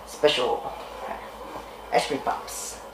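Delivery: sly unvoiced whisper. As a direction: hush it like a secret. Whispering the special ingredient.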